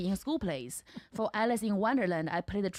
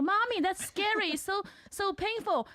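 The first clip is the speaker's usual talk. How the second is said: put-on higher, whiny little-boy voice; imitating her son; 12 semitones higher than usual. Mother mimicking her small son scared by cartoons, high whiny kid voice. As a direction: squeak it out like a scared toddler.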